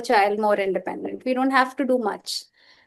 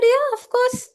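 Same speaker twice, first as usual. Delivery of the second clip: cheery reassurance; 12 semitones higher than usual. Retold or imitated. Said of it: "I said".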